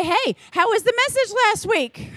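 high, bright friendly question; friendly check-in. Shown as a demonstration. Leader to a team member, asking about last week's message in a high, friendly voice. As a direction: ask it like a cheerful leader checking in. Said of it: "We need to say".

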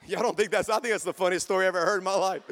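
laughing. Real, not acted.

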